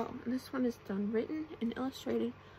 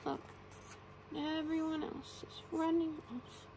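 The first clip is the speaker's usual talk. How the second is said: plain reading voice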